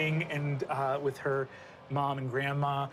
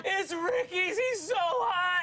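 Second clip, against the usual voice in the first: very high, drawn-out comic sobbing; hammed-up wailing Esther; 13 semitones higher, slower than usual. Girl confessing her crush amid tears, hammed-up high wail. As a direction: bawl it out in a squealing mock cry. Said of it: "Esther's going".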